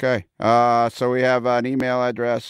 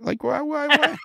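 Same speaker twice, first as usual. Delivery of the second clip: high, whiny repeated why; mock exasperation. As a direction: play-act being baffled and indignant. Mock outrage over Kelly.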